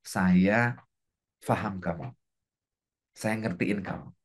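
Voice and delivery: soft, low and understanding; low, reassuring words of understanding; loving kindness. Shown as a demonstration. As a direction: let it sink down gently, with empathy. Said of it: "gedein loving kindness".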